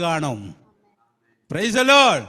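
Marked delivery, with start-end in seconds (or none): 1.5-2.2 a loud, ringing Praise the Lord; loud acclamation. Real, not acted. Preacher calling praise to the congregation, loud. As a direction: throw it out to the church.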